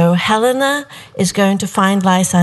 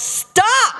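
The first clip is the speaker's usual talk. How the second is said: imitated director's yell. Director halts the kissing scene, high mock yell. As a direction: cry it out high as a retold yell. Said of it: "the director yells".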